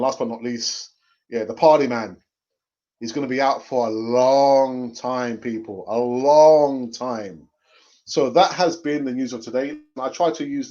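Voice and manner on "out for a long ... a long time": LONG drawn out and swelling louder; stretched-out LONG. Real, not acted. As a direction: drag the word LONG slowly, twice. Drags out how long he's out.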